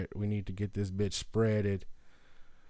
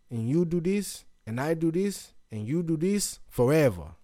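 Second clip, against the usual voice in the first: put-on higher, louder Brazilian-accented martial arts master; 6 semitones higher, 5 dB louder than usual. Master teaching capoeira and jiu-jitsu, put-on Brazilian accent. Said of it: "Brazilian accent all morning".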